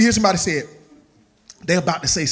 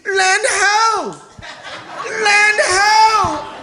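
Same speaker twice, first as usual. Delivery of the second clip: shouting from crow's nest; 12 semitones higher, much slower than usual. Acted. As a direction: yell it down from the mast. Lookout shouting from the mast.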